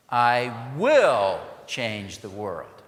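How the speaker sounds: "will" hit loud and slow, held out; stress on WILL. Shown as a demonstration. Leading the room in a pledge, loud stress on will. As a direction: lean into "will" with the whole crowd. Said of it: "Now, will".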